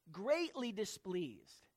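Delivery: ordinary delivery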